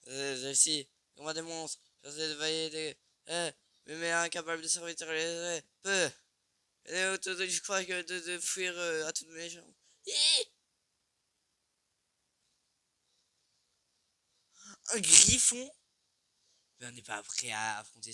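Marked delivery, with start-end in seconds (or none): 0.1-9.7 panicky. Real, not acted. Panicky game commentary. 10.1-15.7 very high, loud startled shriek; startled scream. Real, not acted. Startled by a griffin.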